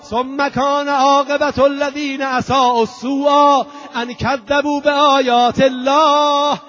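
ringing denunciation of a tyrant; crying out. Acted. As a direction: declaim it high and forceful, stretching the phrase endings. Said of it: "فریاد زد".

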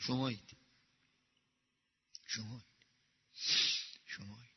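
very low, fading almost to nothing; hushed aside. Real, not acted. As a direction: drop right down and let each repeat die away. Pointed aside repeated to the listeners. (خیلی آرام و محوشونده)

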